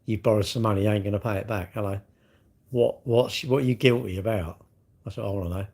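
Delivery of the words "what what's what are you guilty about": usual speaking voice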